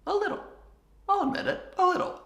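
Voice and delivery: playful high-pitched confession; squeaky admission. Pretend. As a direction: squeak a little sheepishly.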